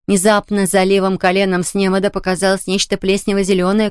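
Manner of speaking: ordinary delivery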